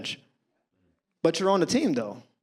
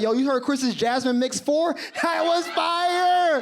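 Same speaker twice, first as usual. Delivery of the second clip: hyped classmates; 8 semitones higher, 3 dB louder, slower than usual. Retold or imitated. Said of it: "People was like".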